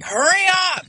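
high, loud impatient shout; imitated yelling. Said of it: "he's, like, yelling at me".

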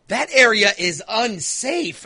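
slow, put-on voice of the worried wife. Wife warning the daughter about the area, slow put-on voice. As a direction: spell out the worry slowly. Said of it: "my wife's like".

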